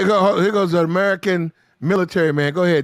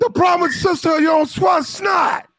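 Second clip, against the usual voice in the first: raised, higher ranting voice; shouting; 9 semitones higher than usual. Real, not acted. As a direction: call it out in a heated rant. Heated rant about American women.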